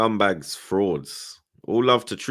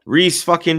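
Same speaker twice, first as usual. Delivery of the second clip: loud, high, excited fan shout; hyped shout; 10 semitones higher, 11 dB louder than usual. Real, not acted. Taunting rival fans, a loud excited shout. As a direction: yell it at your mates with full pride.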